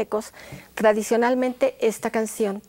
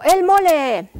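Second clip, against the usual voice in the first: loud, higher called cue; 5 semitones higher, 10 dB louder than usual. Shown as a demonstration. Teacher calls out the mole cue, loud and higher. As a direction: call out the cue word. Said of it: "yo digo".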